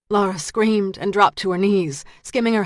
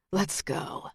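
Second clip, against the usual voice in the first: quiet cold order; 9 dB quieter than usual. A narrator's reading. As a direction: say it with icy calm. Rains orders her flatly.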